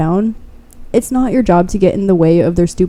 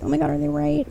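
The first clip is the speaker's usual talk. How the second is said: inner doubt; 5 semitones higher, 11 dB quieter, faster than usual. Retold or imitated. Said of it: "almost like".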